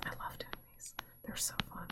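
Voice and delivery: soft whisper to herself, almost unheard; whispered aside. Real, not acted. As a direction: share a giddy secret in a whisper. Aside after getting too excited.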